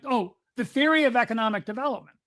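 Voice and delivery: normal talking voice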